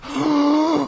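sharp high gasp; shocked gasp. Pretend. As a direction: gasp in horror. Imagines walking in on wife.

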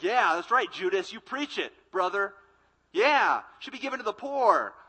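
loud, eager yes-man backing a speaker; mock cheering agreement. Apostles egging Judas on, loud hammed-up agreement. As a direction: cheer him on like an amen corner.

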